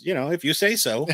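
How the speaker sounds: mildly dismissive quoted reply; a touch of "whatever you say". Acted. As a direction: lightly brush it off. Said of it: "almost dismissively, he says".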